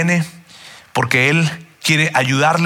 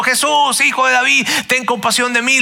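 higher, strained plea, barely louder; louder imitated cry; 7 semitones higher than usual. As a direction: cry out high above the crowd, pleading. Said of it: "este hombre Bartimeo gritando". (mendigo que grita más fuerte entre la gente, grito agudo)

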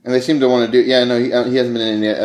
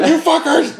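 high, laughing mock outburst; mock angry yell; 3 dB louder than usual. Imitating an absent friend's outraged reaction, laughing. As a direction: play an offended friend yelling. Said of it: "Boyle's shitting his pants".

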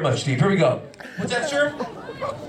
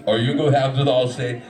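Allstate ad voice; 8 semitones lower than usual. Pretend. Commercial slogan voiced.

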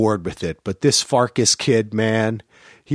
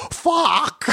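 put-on high squeaky kid curse; a small boy gleefully saying the word; kid's high voice. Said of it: "he'll just go".

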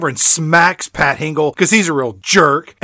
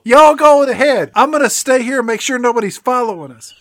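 heroic sacrifice; 7 semitones higher, faster than usual. Pretend. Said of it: "heroic sacrifice bit".

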